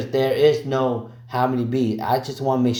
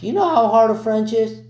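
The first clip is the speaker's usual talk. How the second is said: exasperated outburst; 8 semitones higher, 4 dB louder than usual. Real, not acted. Nail tech exasperated at clients asking for French tips, raised incredulous voice. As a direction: pitch jumps high and loud, incredulous.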